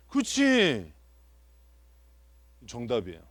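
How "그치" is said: loud, high 그치; excited agreement. Real, not acted. Teacher cheers a congregant's correct answer, loud and high. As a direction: burst out yes, that's it.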